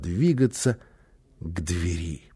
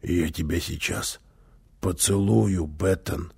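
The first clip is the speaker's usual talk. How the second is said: put-on low, soft, husky boy; hoarse boy's voice; 5 semitones lower, 5 dB quieter than usual. A narrator's reading. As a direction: murmur it like a hoarse, boyish suitor. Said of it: "услышал малыш хриплый, мальчишечий голос". (тихий хриплый мальчишечий голос)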